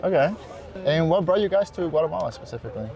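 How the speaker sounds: normal talking voice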